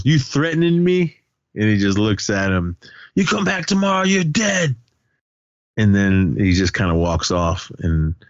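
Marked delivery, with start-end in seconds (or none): 0.0-1.1 high-pitched retold confrontation; indignant challenge, not shouted; mock challenge. Retold confrontation after a threat. 3.1-4.8 very high-pitched mock threat; put-on high voice, threatening; acted threat. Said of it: "Victor threatened".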